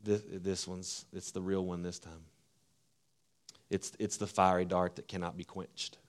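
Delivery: hushed voice of someone who has given up; fearful hushed tone. Retold or imitated. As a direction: mutter it like you're already beaten. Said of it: "saying in the inflection in our voice".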